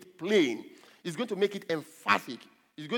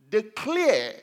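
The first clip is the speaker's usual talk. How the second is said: "Declare" loud and raised; emphatic key word; 5 semitones higher, 6 dB louder than usual. Real, not acted. Preacher supplying the verse's key word, emphatic.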